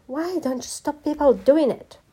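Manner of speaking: high, loud exasperated demand; master's high-pitched furious scolding; angry shout. A narrator's reading. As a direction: shout the question in a temper. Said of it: "shouted the elephant master".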